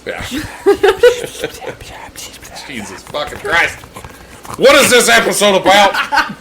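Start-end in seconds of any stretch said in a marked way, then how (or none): 0.3-2.3 wordless whispered ASMR noises; mock inaudible whispering. Shown as a demonstration. Host demonstrates ASMR voice messages to friend, inaudible whispering. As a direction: whisper gibberish sounds, parodying ASMR. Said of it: "it's gonna be inaudible words".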